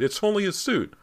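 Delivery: high, loud burst of relief; excited cry. A narrator's reading. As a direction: cry out in giddy disbelief. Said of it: "he cried".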